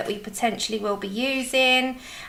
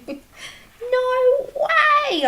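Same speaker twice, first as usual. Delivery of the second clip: much higher squeaky disbelief; retold surprise; 13 semitones higher than usual. Telling viewers about the present, high squeaky disbelief. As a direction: squeak it like you can't believe it. Retelling reaction to gift.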